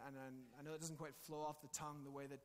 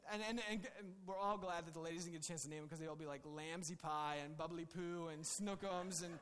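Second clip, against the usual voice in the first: normal talking voice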